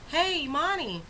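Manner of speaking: high singsong front-desk greeting; calling out. Retold or imitated. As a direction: imitate a receptionist hailing a coworker. Receptionist calls out greeting.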